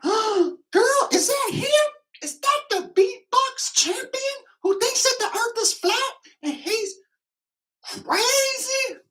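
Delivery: squealing falsetto, mock-starstruck; excited girl impression. Fan whispering to girlfriend about the beatbox champion, falsetto excitement. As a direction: squeak it very high like a gossiping fan. Voicing excited women spotting him.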